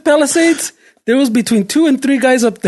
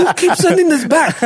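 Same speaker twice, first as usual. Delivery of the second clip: higher, laugh-laced imitation; laughing mock complaint; 5 semitones higher than usual. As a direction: poke fun while laughing. Mocking coworker, laughing.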